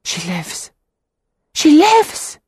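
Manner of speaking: hushed then bursting into a high joyful cry; from a stunned whisper to a joyful shout; joyful realization. A narrator's reading. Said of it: "Peter's heart seized upon the words".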